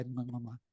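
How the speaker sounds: quiet air kisses; soft kiss sounds. Retold or imitated. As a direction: blow three kisses softly. Mom's goodbye kisses.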